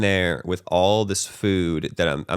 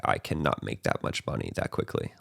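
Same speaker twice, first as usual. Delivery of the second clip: very low, subdued disbelief; retold helplessness; 10 semitones lower, 8 dB quieter than usual. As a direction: drop low and soft, as if beaten. I was just freaking out.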